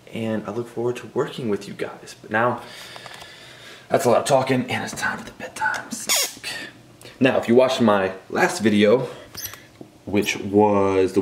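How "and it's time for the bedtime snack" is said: whispered. Acted. Announcing the bedtime snack.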